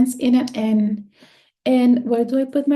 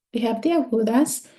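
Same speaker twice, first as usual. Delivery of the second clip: normal talking voice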